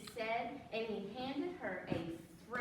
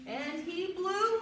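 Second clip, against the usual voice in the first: slow building blow; 6 semitones higher, 6 dB louder, slower than usual. Acted. Blowing balloon building suspense.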